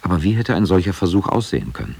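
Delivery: normal talking voice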